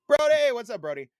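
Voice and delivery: high, loud party yell at a buddy; rowdy mock bar holler; drunk bar shout. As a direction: belt out the name like a drunk greeting. Imitating drunk bar greeting.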